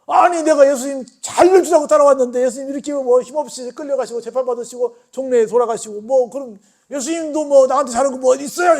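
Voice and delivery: loud, high, bitter protest; resentful complaint. Retold or imitated. Peter snapping back at Jesus, loud bitter complaint. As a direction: throw the blame back at him. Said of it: "베드로도 할 말이 많았을 거야".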